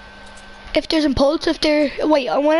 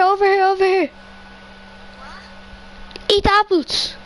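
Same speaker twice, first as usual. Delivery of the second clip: calling from far away; 5 semitones higher than usual. Real, not acted. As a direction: call out to your teammate. Calling teammate to apples.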